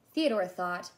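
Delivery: usual speaking voice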